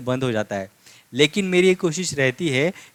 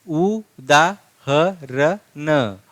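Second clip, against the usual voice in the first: उदाहरण stretched very slowly; drawn-out reading; much slower than usual. Shown as a demonstration. Teacher reading उदाहरण aloud to students, very slowly. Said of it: "कैसे लिखेंगे?".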